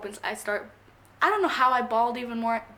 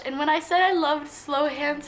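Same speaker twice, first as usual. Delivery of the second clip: normal talking voice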